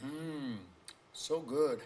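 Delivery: talking between sips of coffee; savoring a sip of coffee; sipping coffee. Real, not acted. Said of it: "let me take a sip of my coffee now".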